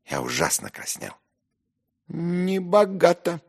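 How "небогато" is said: high, loud, drawn-out pompous verdict; pompous, stretched-out remark; self-important. Acted. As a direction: pronounce it with slow importance. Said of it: "важно заметил Зверков".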